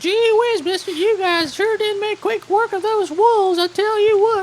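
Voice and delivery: put-on very high gee-whiz kid voice; wholesome 1950s kid. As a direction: sound like an eager little kid. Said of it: "let's go back to a normal hick voice".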